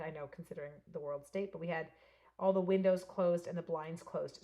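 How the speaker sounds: normal talking voice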